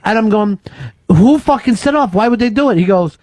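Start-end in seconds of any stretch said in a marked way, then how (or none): 1.1-2.8 high, agitated retold questions; frantic questioning. Host replaying his helpless dream questions about the bomb, frantic. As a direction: demand answers as if out of your mind. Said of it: "I'm out of my mind in this fucking dream".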